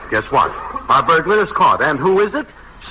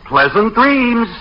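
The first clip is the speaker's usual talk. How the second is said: slow, higher, mock-sweet good night; sarcastic farewell; 6 semitones higher, much slower than usual. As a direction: draw it out sweetly to dismiss him. Sarcastic sign-off to jailed uncle.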